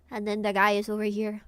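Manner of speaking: normal talking voice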